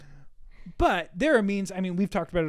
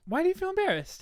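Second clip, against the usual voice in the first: high, squeaky mock question; high teasing; 13 semitones higher than usual. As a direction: pitch it way up teasingly.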